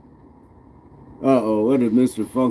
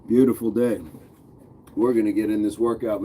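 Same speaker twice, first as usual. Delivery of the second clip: ordinary talk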